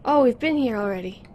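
normal talking voice

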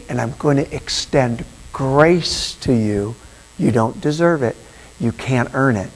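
soft, low, slowing merciful voice; gentle king. Acted. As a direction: speak as a king granting pardon. Voicing the forgiving king.

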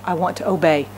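ordinary delivery

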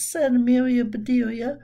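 ordinary delivery, nothing marked

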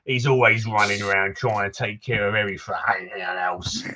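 put-on townsman in a Cockney accent, gruff and chatty; grumbling Cockney local greeting a stranger; Cockney NPC. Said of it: "for the accent compliment".

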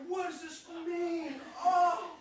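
high, slow, loud wailing question; fake-cry with overwhelmed joy; mock tearful awe. Parody driver at the drive-thru, mock crying in awe. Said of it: "he starts crying".

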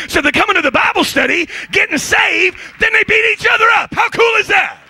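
high, building preacher shout; preaching shout. Real, not acted. Preacher telling the crowd the story's payoff, rising to a shout.

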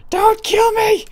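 very high, shrieking plea; real terror, voice jumping way up; panicked plea. Real, not acted. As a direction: beg the monster for your life. Begging the monster in panic.

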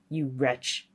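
low storybook villain voice; menacing old man. Acted. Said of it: "he cried".

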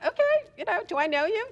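high bemused reply; amused surprise. Retold or imitated. Answering a stranger offering biscotti, high and bemused. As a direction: react cheerfully puzzled. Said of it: "I'm like".

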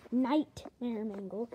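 slow, drawn-out, emphatic announcement of the name; dramatic name reveal. Shown as a demonstration. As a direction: savor the name slowly like a showman. Repeats name, slow and louder.

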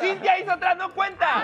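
high, emphatic ruling; loud protest. Real, not acted. Host disqualifying a guess, loud protest. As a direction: call the foul over the noise.

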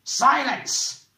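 high, loud bellow; roared. A narrator's reading. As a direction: roar to shut him up. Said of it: "roared the man".

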